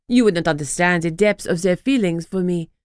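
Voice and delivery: put-on French accent, haughty; French-accented disdain. Ex-wife lecturing Lucy, French accent. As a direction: look down on her in a French accent.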